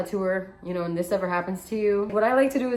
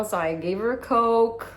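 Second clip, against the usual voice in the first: ordinary delivery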